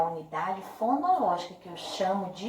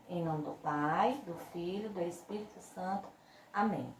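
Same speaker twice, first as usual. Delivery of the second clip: soft, unhurried teacher's prayer for repeating; children's prayer; 3 semitones lower, 4 dB quieter than usual. Acted. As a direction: lead small children in prayer, line by line.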